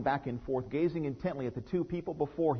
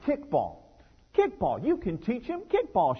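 rising, louder push for kickball; insist, getting higher on you; eager insistence; 10 semitones higher, 7 dB louder than usual. Retold or imitated. Wife insisting to husband, excited and louder.

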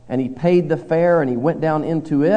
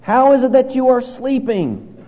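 higher, louder startled captain; alarmed captain; 9 semitones higher, 4 dB louder than usual. Retold or imitated. Captain waking sleeping Jonah in the storm, alarmed and raised. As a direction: demand it like a frightened captain. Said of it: "notice what he says".

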